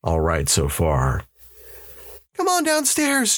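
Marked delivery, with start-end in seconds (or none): 2.4-3.4 much higher, wavering woman's voice. A narrator's reading. Mother calling her twins downstairs, wavering voice. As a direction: call to the children with a waver. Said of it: "Janet called in a wavering voice".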